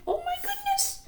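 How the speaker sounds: very high, soft squeaky gasp; a little mouse-voiced fright; Pearl's fright. A narrator's reading.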